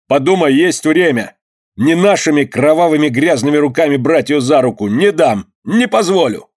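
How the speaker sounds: a rising, passionate refusal; defiant. A narrator's reading. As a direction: stand your ground in anger. Rival defies the gang leader.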